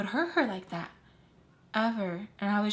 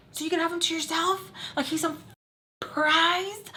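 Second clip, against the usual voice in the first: retold yelling; 8 semitones higher than usual. Yelling at her friend about the guy, high angry mock yell. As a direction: fling it back at her like an accusation. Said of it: "I'm just, like, yelling at her".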